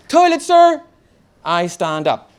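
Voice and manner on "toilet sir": high, loud desperate call; yelled request for the toilet; shouted plea. Acted. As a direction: blurt it out to the teacher. Boy begging to leave class.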